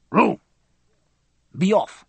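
loud curt shout. A narrator's reading. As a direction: bark it out loud. Official shouts at narrator.

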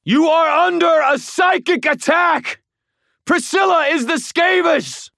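booming urgent shout; thundering. A narrator's reading. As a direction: thunder the warning. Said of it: "I thundered".

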